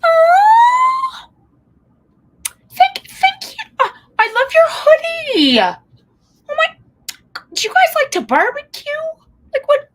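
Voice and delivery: over-the-moon parent gushing in a high squeal; voice up octaves. Retold or imitated. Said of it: "your voice goes up a couple of octaves".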